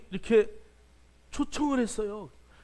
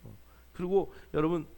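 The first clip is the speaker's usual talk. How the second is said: usual speaking voice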